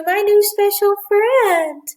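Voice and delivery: high, gushing puppy introduction. Real, not acted. Teacher introducing her new puppy to class, excited high baby talk. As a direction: show off a special little friend in a high voice.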